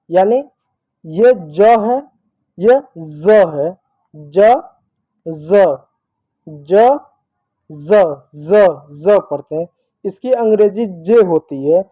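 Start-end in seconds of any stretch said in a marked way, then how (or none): 1.0-9.2 repeats ज as a buzzing z, not j; z sound demo. Shown as a demonstration. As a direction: say ज again and again, stretching the z buzz. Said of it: "तो इसको ज पढ़ते हैं". (नुक्ता वाला ज़, ज़ की तरह भनभनाकर)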